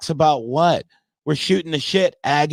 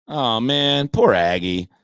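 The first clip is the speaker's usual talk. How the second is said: normal talking voice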